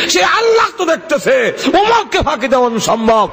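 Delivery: high, ringing protest in a preacher's retelling; impassioned daughter. Retold or imitated. Daughter refusing to water the milk, acted high fervent protest.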